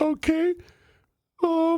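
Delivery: very high put-on hesitant voice; mock spelling-bee contestant. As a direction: play an unsure contestant up high.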